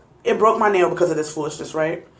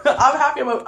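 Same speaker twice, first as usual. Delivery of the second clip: laughing nervously into higher-pitched words; 6 semitones higher than usual. Real, not acted. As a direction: let a nervous giggle spill into the words. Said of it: "it's a nervous laugh".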